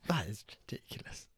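unvoiced, hushed disbelief; a whispered that is ridiculous. Real, not acted. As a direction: breathe it out in disbelief. Whispered aside after rant.

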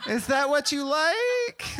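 very high, slow put-on girl voice; mock clueless girl.

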